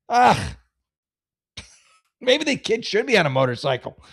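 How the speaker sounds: ordinary talk, nothing marked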